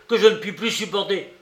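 exasperated rapid protest; furious cry. A narrator's reading. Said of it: "cria Raskolnikov furieux".

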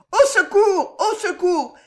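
very high, loud cry for help. A narrator's reading. Mademoiselle d'Armilly ringing the bell, screaming for help. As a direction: shriek for help. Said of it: "cria mademoiselle d'Armilly".

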